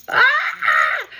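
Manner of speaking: sudden very high overwhelmed yelp; high-pitched emotional squeal; high emotional cry. Real, not acted. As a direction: let a cry escape, moved to tears. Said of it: "I'm getting emotional".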